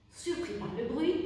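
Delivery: usual speaking voice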